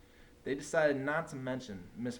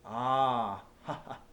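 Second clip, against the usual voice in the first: witchy laugh; 5 dB louder, much slower than usual. A narrator's reading. Miss Swamp discovers the mask.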